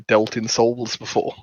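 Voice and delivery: ordinary talk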